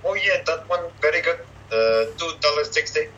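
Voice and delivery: high-pitched imitation Vietnamese accent. Comedian's shopkeeper quotes a price, Vietnamese accent. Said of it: "like, a Vietnamese voice".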